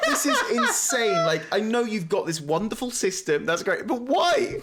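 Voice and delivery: high-pitched, incredulous complaint; mock exasperation. Player complaining to the game's makers, exasperated and high. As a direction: plead upward in comic disbelief.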